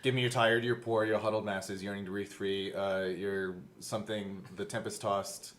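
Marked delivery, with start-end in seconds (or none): none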